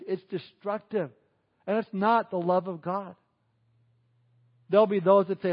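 ordinary delivery

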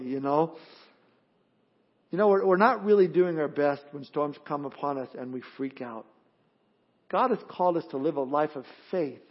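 normal talking voice

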